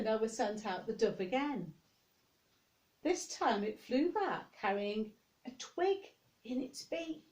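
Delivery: ordinary delivery, nothing marked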